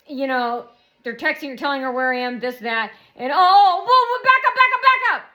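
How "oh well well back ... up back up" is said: loud, high-pitched alarm call; exclaiming halt. Acted. Stopping the story mid-claim, loud high outburst. Acts out abruptly stopping the talk.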